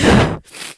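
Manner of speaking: a burst of airy laughter; loud breathy laugh. Real, not acted. Vlogger laughing at herself after a sigh, loud breathy laugh.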